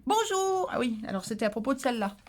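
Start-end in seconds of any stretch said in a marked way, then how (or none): 0.0-0.7 a high, sung-out, pointed "Bonjour". Retold or imitated. Answering the viewer who forgot hello, pointed sung bonjour. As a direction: answer a rude tip with a pointed greeting. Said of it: "j'ai répondu".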